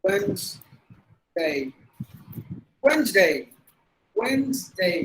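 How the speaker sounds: Wednesday as WENZ, day; Wednesday split, then joined as WENZ-day; silent D. Shown as a demonstration. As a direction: model Wednesday in two parts, no d. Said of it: "This is how we have to pronounce".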